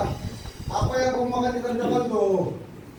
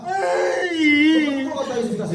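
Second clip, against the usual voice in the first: drawn-out commanding cry; demanding shout; 7 semitones higher, much slower than usual. Real, not acted. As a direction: force each word out slowly at the spirit. Exorcist demanding forcefully.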